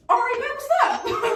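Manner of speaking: high, laughing invitation to fight; high mock ready-to-fight. Girlfriend unzips her jacket, so she invites her to fight.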